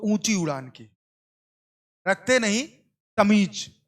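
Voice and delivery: slow, measured poetic recitation; ghazal recitation. Pretend. Teacher recites a ghazal to students, slow and measured. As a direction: savour each line of the ghazal slowly. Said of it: "तो उनके लिए एक शायरी है".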